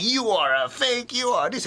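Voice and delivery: a crazy dude ranting at the teacher; half-hearted retold scream; mock angry yell. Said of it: "starts screaming".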